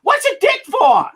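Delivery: high, slow, puzzled question; the mother-in-law asks innocently, high and slow; elegant mother-in-law asking. Retold or imitated. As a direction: play the prim mother-in-law, baffled. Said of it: "she goes".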